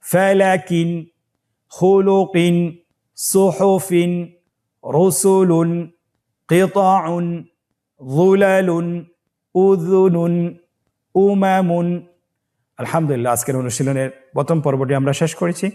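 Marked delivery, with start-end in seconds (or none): 0.0-11.9 each word higher and apart, clearly pronounced; recite for the class. Shown as a demonstration. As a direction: model every word for students to repeat. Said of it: "আপনারা আমার সাথে বলুন". (ছাত্রদের জন্য প্রতিটি শব্দ স্পষ্ট করে উচ্চারণ)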